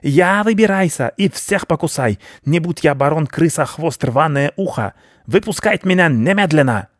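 high, furious rat baron; pompous rodent rage in broken speech; squealing rat. A narrator's reading. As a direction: screech threats from inside the trap. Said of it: "визжал он".

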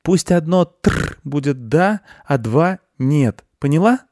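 put-on high, eager boy's voice; high-pitched child laying down rules; little boy. Boy explains code to beetle.

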